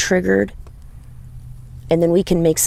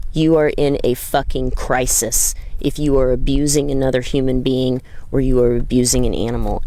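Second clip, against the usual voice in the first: ordinary delivery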